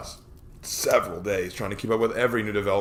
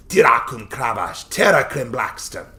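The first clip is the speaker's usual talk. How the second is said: orc tongue; 3 semitones higher, 6 dB louder than usual. A narrator's reading. Orc girl speaks orc tongue.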